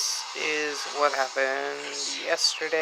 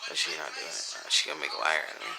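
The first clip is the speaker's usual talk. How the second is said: muttering; 11 semitones lower, 5 dB quieter, much faster than usual. Real, not acted. Aside, low and fast.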